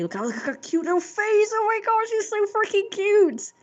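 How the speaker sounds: very high-pitched squeal of adoration; gushing over cuteness. Real, not acted. Gushing over the cute little drawing, high adoring squeal. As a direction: coo and squeal as if over a tiny pet.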